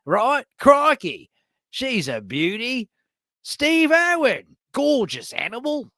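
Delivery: high-pitched, hammed-up Australian excitement; exaggerated Aussie accent. Shown as a demonstration. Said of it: "when I do it like this".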